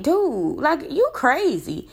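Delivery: high, incredulous lecturing; retold scolding of the boyfriend, pitched high; exasperated scolding. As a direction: set him straight, fed up. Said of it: "And I'm like".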